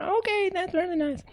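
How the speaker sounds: very high, squeaky little ant voice; tiny ant voice. Acted. Tiny ants answering the raccoon in squeaky little voices.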